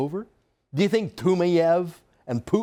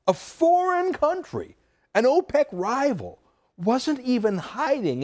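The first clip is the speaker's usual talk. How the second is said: slow, much higher outraged stress; a foreign country, said slowly and high in outrage; indignant disbelief; 8 semitones higher, slower than usual. Real, not acted. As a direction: stretch it out in disbelief. Outraged emphasis.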